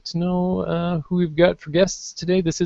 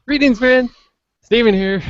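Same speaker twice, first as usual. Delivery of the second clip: bright, higher, louder host greeting; podcast host intro; 8 semitones higher, 6 dB louder than usual. Acted. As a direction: open the show like a showman. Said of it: "I know what it is".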